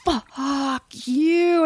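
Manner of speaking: high, drawn-out venomous curse; imitating a spiteful driver; mouthed with venom. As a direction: stretch it out as if mouthing through a car window. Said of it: "mouthing with venom".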